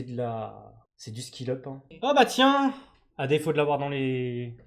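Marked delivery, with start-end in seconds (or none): 2.0-2.7 exclamation aiguë et forte; s'exclamer, pris de surprise; vive surprise. Real, not acted. Réaction à un tirage inattendu. (vive surprise, voix haut perchée)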